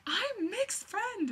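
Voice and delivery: very high and slow, savoring each word; giddy fangirl. Retold or imitated. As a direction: squeal it like a thrilled teen. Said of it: "and then I was like".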